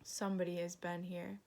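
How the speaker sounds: ordinary delivery, nothing marked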